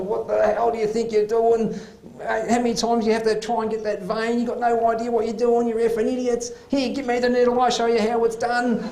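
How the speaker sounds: lightly mock the patient shouting at staff; yelling patient. Overdosed patient abusing the doctor, mild mock yelling. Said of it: "She's just yelling, abusing the staff".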